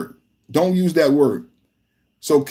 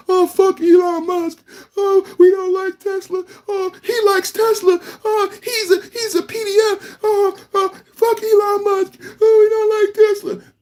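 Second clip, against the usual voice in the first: much higher, whining, fake-sobbing complainer; squeaky falsetto mock-wail of someone hating Tesla; mock whiny crybaby. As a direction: mimic a sniveling protester wailing about Tesla. Mocking Californians who hate Tesla.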